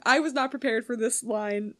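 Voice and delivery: ordinary talk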